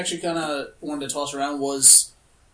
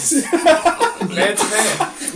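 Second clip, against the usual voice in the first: laughing; 6 semitones higher, 5 dB louder, much slower than usual. Real, not acted. Laughing, drawn out.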